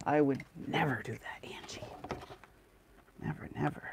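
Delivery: mock innocent whisper. Whispering a teasing denial to a friend.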